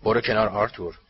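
cold, rough order; cold and harsh. A narrator's reading. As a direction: order a man aside coldly. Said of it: "صدایی سرد و خشن". (دستور سرد و خشن)

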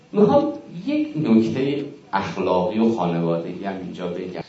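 ordinary talk

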